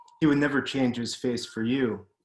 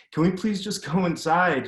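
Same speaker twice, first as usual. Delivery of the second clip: higher, louder desperate plea; pleading; 7 semitones higher, 4 dB louder than usual. Retold or imitated. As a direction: beg him to stop, voice pushed up. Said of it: "you pleaded".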